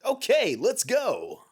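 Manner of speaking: high, loud mock taunt; taunting announcer. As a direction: tease then start the song.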